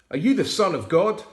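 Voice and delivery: loud, high accusing question; demanding. A narrator's reading. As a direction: demand it like a hostile judge. Leaders interrogate Jesus.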